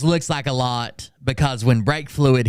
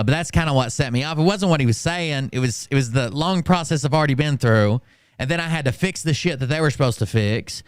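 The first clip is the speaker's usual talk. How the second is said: usual speaking voice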